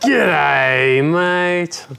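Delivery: Australian g'day. Shown as a demonstration. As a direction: hail them like a cheerful Aussie. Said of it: "give us a "Good day, mate".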